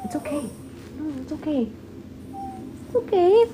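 high, soft cooing reassurance; soothing pet talk. Real, not acted. Owner soothing her crying dog, high cooing. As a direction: coo it high to comfort a pet.

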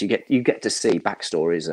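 ordinary delivery

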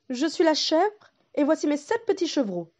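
mother goat. Acted.

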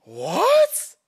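high loud startled what; shocked. Real, not acted. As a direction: blurt it out in disbelief. Shocked by release date.

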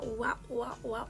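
ordinary talk, nothing marked

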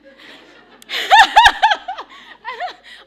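loud, high belly laugh; big burst of laughter. Real, not acted. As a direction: let the laugh explode. Audience-shared joke about translator.

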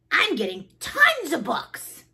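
high, excited little frog voice; excited cry. Acted. Froggy boasts about the library, excited cry. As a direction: crow it with big emphasis on tons. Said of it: "cried Froggy".

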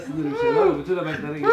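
high squealing laugh; laughing fit. Real, not acted. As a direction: laugh helplessly in frustration. Laughing fit over ruined dominoes.

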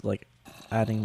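ordinary talk, nothing marked

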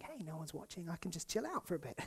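hushed slacker thoughts; sneaky inner voice. Retold or imitated. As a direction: say it like a guilty secret to yourself. Voicing private lazy thoughts.

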